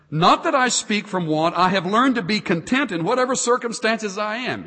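lifted, declaiming reading of the verse; scripture reading. Acted. Pastor reading Paul's letter, in a lifted preaching voice. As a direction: proclaim the apostle's words.

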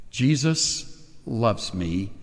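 put-on slow, soft, low recital of the children's song; gentle, hushed hymn words; tender hymn recital. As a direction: hush and savour each line.

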